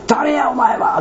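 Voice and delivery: high, sharp challenge; demanding shout. Retold or imitated. Preacher retelling his dream, shouting at the silent stranger. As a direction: demand who he is, voice up.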